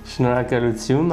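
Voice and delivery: fast Armenian thanks. Shown as a demonstration. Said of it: "mais dis-le lentement".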